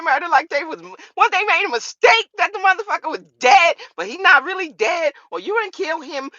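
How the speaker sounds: loud, high, incredulous tirade; heated rant. Real, not acted. Ranting at the rapper over the murder charge, loud and high. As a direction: tear the story apart at full volume.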